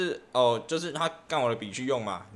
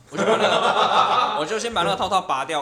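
laughing; 4 semitones higher, 7 dB louder than usual. Real, not acted. Laughing while telling prank.

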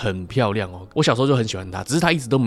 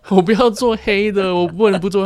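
mock pleading girl; 8 semitones higher, 4 dB louder than usual. Girl pleading with gangster, retold.

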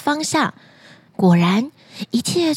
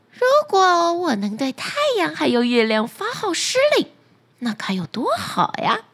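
higher, dreamy, grasping voice; greedy wife dreaming; 4 semitones higher than usual. Acted. Greedy wife dreaming of commanding sun and moon, high. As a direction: savour the idea of ruling the sun.

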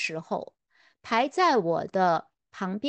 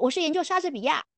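higher, quick, mildly proud reply; proud answer; 6 semitones higher, faster than usual. Retold or imitated. English lecturer proudly naming his field, higher and quick. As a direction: let a little pride show as you name it. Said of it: "他就很自豪说".

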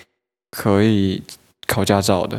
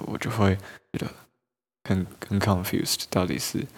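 ordinary talk

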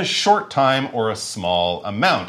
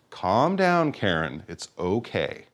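much softer, lower calming; soothing; 6 semitones lower, 6 dB quieter, slower than usual. Retold or imitated. Teacher calming an upset Karen, soft and soothing. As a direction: settle her down gently.

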